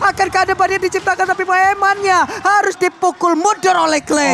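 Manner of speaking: fighting game announcer. Acted. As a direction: belt it out like an arena announcer. Hype call of the fight.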